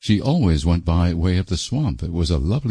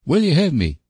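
rustic suitor; 10 semitones higher than usual. Acted. Sam repeats his proposal.

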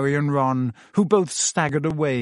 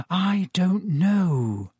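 drawn-out, higher, doubtful words; slow and hesitant; 5 semitones higher, much slower than usual. A narrator's reading. Hermione hesitates over visiting Hagrid at night, slow and doubtful. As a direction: stretch each word out with reluctance. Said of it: "Hermione said slowly".

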